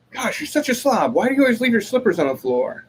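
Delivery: high-pitched exasperated scolding; mock nagging. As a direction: play-act an annoyed spouse's complaint. Voices annoyed wife's complaint.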